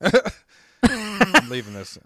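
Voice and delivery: high, slow, loud and laughing. Real, not acted. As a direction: crack up while saying it. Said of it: "I'm leaving this in".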